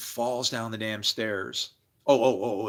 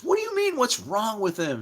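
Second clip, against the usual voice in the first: high, loud mother demanding answers; indignant outburst; 11 semitones higher, 3 dB louder than usual. Retold or imitated. Mother snapping at the school head, outraged. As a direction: unload on him, outraged. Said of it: "She unloads".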